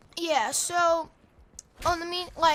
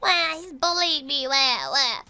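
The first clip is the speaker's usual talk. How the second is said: mock crybaby whine; 4 dB louder than usual. Mocking the kid crying to the principal, fake crybaby whine. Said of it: "I was like".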